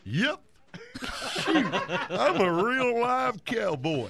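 slow, hammed-up cowboy drawl; exaggerated, drawn-out cowpoke; bad cowboy. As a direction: stretch every word like a corny movie cowboy. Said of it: "You kinda sound like Barney".

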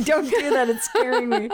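high, laughing fake scare; laughing mock fright. Laughing at her sister's tooth, mock scared and high. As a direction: squeal it in pretend fear through laughter.

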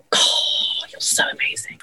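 breathless awestruck whisper; hushed admiring whisper; awed whisper. Retold or imitated. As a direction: whisper it in wonder from the wings. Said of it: "just going".

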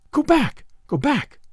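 urgent high cry of go back; lightly voiced pleading woman; cried out. A narrator's reading. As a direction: cry out to stop him. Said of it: "she cried".

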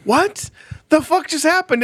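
high, incredulous outburst; shocked disbelief. Retold or imitated. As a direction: react like the floor vanished. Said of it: "it's just like".

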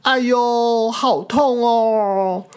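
high, drawn-out ouch of pain; hurt dinosaur cry. Acted. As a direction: whimper it like a bruised little dinosaur.

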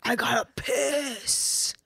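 very slow, drawn-out comic croon; hammed-up shameless declaration; blunt announcement. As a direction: stretch every word absurdly. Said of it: "we just go like".

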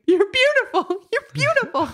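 high-pitched mock blubbering; imitated sobbing scream. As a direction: cry it out in overwhelmed adoration. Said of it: "crying and sobbing and screaming".

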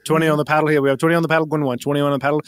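brisk auctioneer calling bids. Acted.

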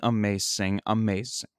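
normal talking voice

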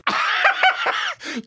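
high burst of laughter; big laugh. Real, not acted. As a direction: laugh out loud in delight. Host reacts to played clip.

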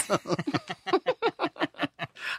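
high squealing laugh. Real, not acted. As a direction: crack up high. Big laugh at moist.

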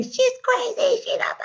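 high, thin, drawn-out mock mouthing; exaggerated mouthing. Imitating the client mouthing behind Anna's back, exaggerated and squeaky. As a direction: squeeze it out like a secret aside. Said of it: "and she's mouthing".

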